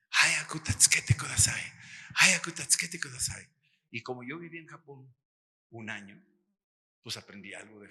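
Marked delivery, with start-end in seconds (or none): 0.1-3.5 an urgent, pleading whisper in Japanese. Retold or imitated. The woman in the vision begging for help, in an urgent whisper.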